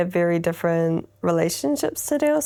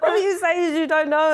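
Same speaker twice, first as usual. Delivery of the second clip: high-pitched mock scolding with laughter; playful retold nagging; mum telling off; 9 semitones higher, faster than usual. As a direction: imitate a fed-up mum, half laughing. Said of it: "even my mum told me off".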